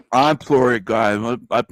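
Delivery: halting apology through pretend food; mock stuffed-cheek sheepish apology; mouth-full Tarek impression. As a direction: mumble it as if chewing. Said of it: "impression of Tarek while he's eating".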